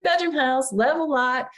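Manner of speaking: put-on quoting the teacher in a Southern Tallahassee accent. Imitating her instructor Gene in a Tallahassee accent. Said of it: "like, a Tallahassee accent".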